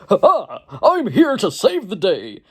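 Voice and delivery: high, slow heroic cartoon hero voice; heroic squirrel. Mocking the brave squirrel hero, cartoon hero voice. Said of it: "who is always like".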